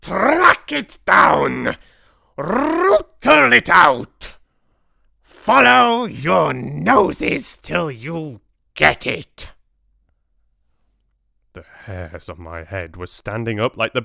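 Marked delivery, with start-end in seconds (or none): 0.0-9.5 slow, very loud, high commands; screaming Grand High Witch. A narrator's reading. Grand High Witch sends witches hunting, slow screamed orders. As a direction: scream orders, stretching every word. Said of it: "screamed the Grand High Witch".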